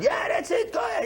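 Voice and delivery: high, shouted egging-on from behind the drums; retold yelling. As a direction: yell him on like the drummer did. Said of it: "screaming at me".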